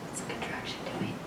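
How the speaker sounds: quick, very soft whisper; hushed, hurried whispering; whispered prompt. Real, not acted. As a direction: whisper a hint to the class. Whispered aside to class.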